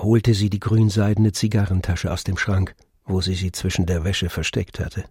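ordinary delivery

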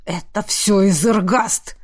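slow, snarling blame; growl. A narrator's reading. Jealous man blaming his rival, growling. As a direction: growl it through the teeth. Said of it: "прорычал Джаф".

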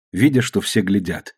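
ordinary delivery

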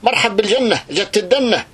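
rally the faithful at full force; fiery preaching. Acted. Preacher hailing news of war on Libya, fiery shout.

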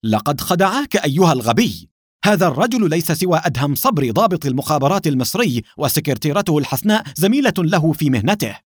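agitated, forceful storyteller's anger; angry through gritted teeth. A narrator's reading. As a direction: tell off a fool in anger. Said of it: "وقال وهو يضغط على أسنانه غضبا".

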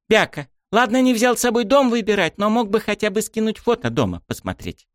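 put-on high, pouty young girl's voice; cheeky girl. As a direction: sulk like a bratty girl. Miyami's voice in narration.

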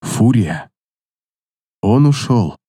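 low, slow, grieving voice; pained. A narrator's reading. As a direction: gently break the news. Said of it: "Со страданием в голосе".

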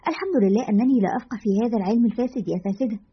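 ordinary delivery, nothing marked

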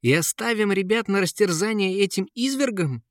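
high, outraged question; frenzied exclamation. Acted. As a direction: exclaim in disbelief, pitch way up. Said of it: "иступлённо воскликнул принц". (исступлённое восклицание)

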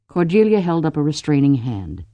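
usual speaking voice

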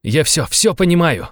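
slow, high, impassioned cry; agitated, drawn-out exclamation; frenzied outburst. A narrator's reading. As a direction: hammer out the realisation. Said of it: "подскочил он к нему".

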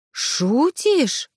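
high incredulous gasp of a question; you can't believe your ears; mildly voiced chorus of disbelief; surprised. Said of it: "хором удивились мы".

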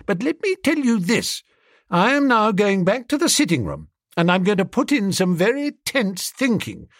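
high-pitched, affronted declaration; huffy indignation. Acted. Bertie huffily rebukes unhelpful Jeeves.

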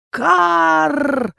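high triumphant raven caw; drawn-out bird call; raven proclaims. A narrator's reading. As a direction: announce it like a raven. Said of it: "провозгласил ворон".

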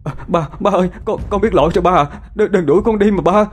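high, stammering plea to a father; desperate pleading. Acted. Son begging his father not to throw him out, desperate pleading.

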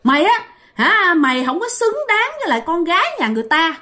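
high, fast scolding voice of the scary woman; high-pitched hostile telling-off; menacing woman's warning. A narrator's reading. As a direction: jab out an angry threat, pitched high and rapid. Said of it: "bả mới chỉ vô mặt bạn, bả mới nói rằng".

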